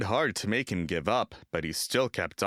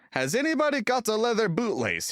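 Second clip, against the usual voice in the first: guard calling out; 13 semitones higher, 3 dB louder, faster than usual. A narrator's reading. Guard asking passengers.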